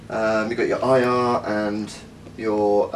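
normal talking voice

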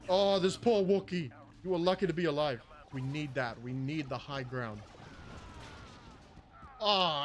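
ordinary talk, nothing marked

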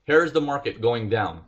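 ordinary delivery

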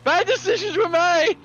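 high shrieking laughter, slow words; laughing shout. Real, not acted. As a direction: laugh hysterically while shouting. Laughing through the crash.

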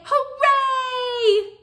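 loud, high, drawn-out hooray; cheer. Shown as a demonstration. Reader shows children what to say, cheering. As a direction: cheer it long and high for everyone to copy. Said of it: "Can everyone say".

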